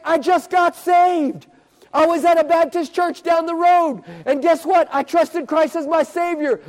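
high, loud, emphatic testimony; jubilant announcement. Retold or imitated. Noel tells the preacher he got saved, jubilant and loud. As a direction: proclaim the good news at full pitch. Boy's joyful news retold.

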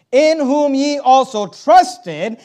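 preacher rereading to stress trusted; slow emphatic reading. Acted. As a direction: lay the verse down slowly. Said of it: "When we use the word believe".

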